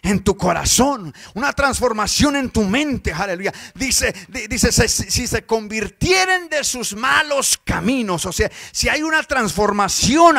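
loud, driving sermon voice, half sung; full-force preaching. Acted. Preacher urges the church to change, loud and fervent. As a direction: preach it out to the congregation.

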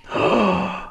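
high, drawn-in gasp. Real, not acted. Player spotting gold, gasping. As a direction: suck in a sudden breath. Spots something in the game.

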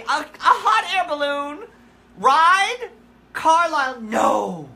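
high, loud disbelief; incredulous protest. Retold or imitated. Owner answers the cat's hot air balloon idea, loud incredulous protest. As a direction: balk at it, voice shooting up loud.

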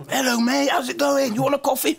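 very high, animated greeting; chirpy arriving host. Retold or imitated. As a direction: play the host breezing in with an offer. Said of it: "he walks in".

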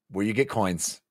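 ordinary talk, nothing marked

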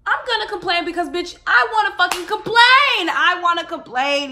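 loud, high ranting complaint. Real, not acted. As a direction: yell it out like a fed-up rant. Ranting loudly about complaining.

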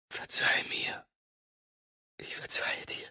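tearful whispered forgiveness; weeping murmur. A narrator's reading. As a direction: forgive him through tears, barely voiced. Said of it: "murmelte sie weinend".

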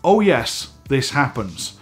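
usual speaking voice

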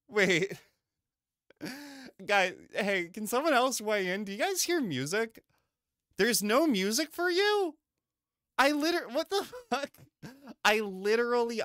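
can't believe no one hears it; disbelief. Real, not acted.

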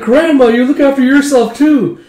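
high, loud farewell call; calling to grandma. Retold or imitated.

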